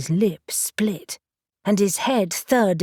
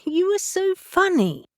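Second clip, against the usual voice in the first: much higher, giggly gushing; tittering; 12 semitones higher than usual. Acted. Cassie giggling at Reuben's grumbling, high and tittery. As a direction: squeal it through giggles. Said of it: "Cassie was tittering".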